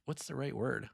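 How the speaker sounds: ordinary talk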